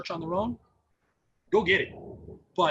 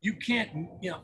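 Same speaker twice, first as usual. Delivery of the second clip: usual speaking voice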